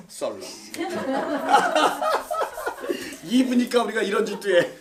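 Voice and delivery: usual speaking voice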